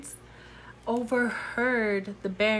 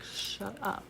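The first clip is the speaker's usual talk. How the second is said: silent warning look; 11 dB quieter than usual. Retold or imitated. Gideon warning her with his eyes, hushed mock warning. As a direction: say it under your breath, as if only with the eyes. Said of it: "with his eyes".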